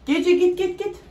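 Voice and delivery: loud high shooing call. Real, not acted. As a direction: shoo the cat off loudly. Shooing the cat away.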